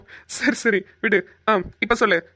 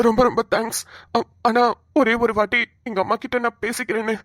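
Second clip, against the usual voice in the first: usual speaking voice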